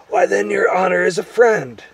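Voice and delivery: high, loud, relieved creature voice; relieved gnome. A narrator's reading. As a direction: exclaim with relief as a little gnome. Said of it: "gave a huge sigh of relief".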